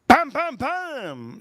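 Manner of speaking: high, slow "pam" sound effect; imitated tapping noise. Imitating girlfriend preparing the dog's food, drawn-out noises. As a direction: draw out each high-pitched pam.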